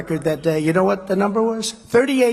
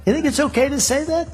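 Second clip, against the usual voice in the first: higher-pitched incredulous question; quoted question; 8 semitones higher than usual. Retold or imitated. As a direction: ask it in a raised pitch. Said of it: "I said".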